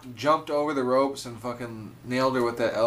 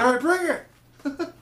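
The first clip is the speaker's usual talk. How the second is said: high-pitched imitation of a yelling ref; referee yell; 13 semitones higher, 4 dB louder, much slower than usual. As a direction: yell it out slow, mimicking the referee. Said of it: "the ref yells".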